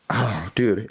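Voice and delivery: normal talking voice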